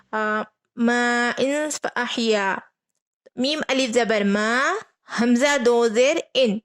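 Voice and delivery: slow recitation, nasal hum before فا احیا; slow Quran spelling. Shown as a demonstration. Teacher spells out ما ان فا احیا for students slowly. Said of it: "تو اب یہاں پر".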